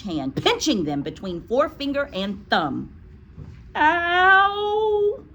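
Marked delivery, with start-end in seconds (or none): 3.7-5.2 high, loud drawn-out yelp; cried out in pain. A narrator's reading. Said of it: "Eric cried".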